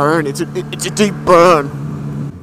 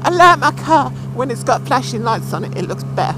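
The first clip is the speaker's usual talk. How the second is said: mock driver voice; 8 semitones higher than usual. Mocking a driver indicating for nothing.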